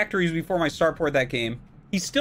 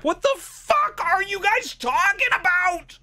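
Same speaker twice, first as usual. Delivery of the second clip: furious shout; 12 semitones higher than usual. Real, not acted. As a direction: scream your disbelief at bad advice. Raging at chat tips.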